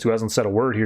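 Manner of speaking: usual speaking voice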